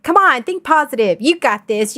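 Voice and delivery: mock pep talk, starting high; lightly put-on encouragement; pushy cheerleading. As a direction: imitate a chirpy positive-thinking friend. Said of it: "and they're like".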